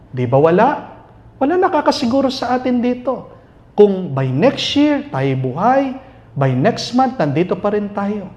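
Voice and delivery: ordinary delivery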